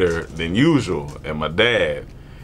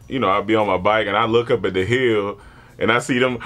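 usual speaking voice

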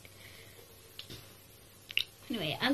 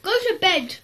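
telling the dog off to bed; a firm, loud order to the dog; firm command, loud; 5 semitones higher, 6 dB louder than usual. Real, not acted. Ordering her dog to bed, firm and loud.

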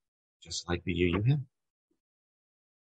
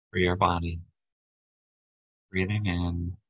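even, unhurried meditation voice with long pauses; guided meditation. Acted. Leader guides a group to relax body and breath, guided meditation. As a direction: guide them gently through each breath, leaving space between phrases.

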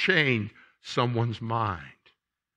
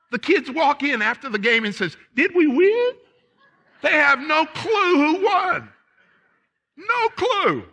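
much higher and louder, building up the punchline; excited storytelling; 6 dB louder than usual. Real, not acted. Preacher tells the congregation about T-ball kids, high and excited.

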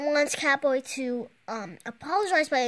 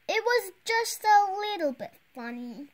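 arguing; 7 semitones higher than usual. Acted. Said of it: "Connor argues".

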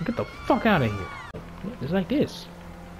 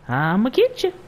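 sing-song threat pitched way up; high playful taunt; 8 semitones higher, 3 dB louder than usual. Pretend. Player chasing someone in game, high playful taunt. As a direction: tease in a high, mock-menacing voice. Same taunt repeated higher.

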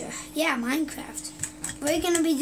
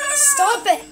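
sharp shouted stop; 9 semitones higher, 6 dB louder than usual. Real, not acted. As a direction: yell stop it at him. Telling friend to stop.

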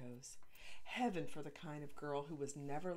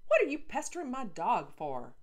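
louder, higher indignant yell; angry shout; 8 semitones higher, 11 dB louder than usual. Acted. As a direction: yell at the girl defending her dog.

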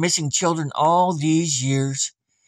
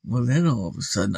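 plain reading voice

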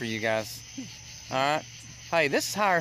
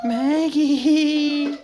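calling out; 10 semitones higher, 6 dB louder than usual. Real, not acted. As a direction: call her name loud and high. Calling out to kid.

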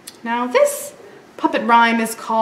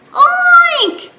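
high squealed oink; piglet oink cry; 8 dB louder than usual. Acted. Lost piglet crying out, high oink. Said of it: "cried Piglet".

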